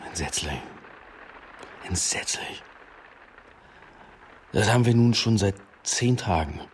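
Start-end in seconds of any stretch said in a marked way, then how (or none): 0.1-0.6 dropped low and soft, muttered to himself at the glass; a low, soft groan of a word; low murmur. Acted. Commissioner muttering to himself at the window, low and soft. 1.8-2.6 a weary whispered echo of the same word. Acted. Commissioner repeating it to himself, whispered.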